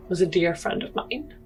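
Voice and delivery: normal talking voice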